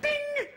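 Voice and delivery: high sung ding of a call bell; ping it like the seat bell; bright high bell chime. Retold or imitated. Call button sound.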